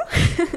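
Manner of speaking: short unvoiced giggle; a quick airy chuckle; breathy laugh. Real, not acted. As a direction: laugh it off under your breath. Laughing at her own fall.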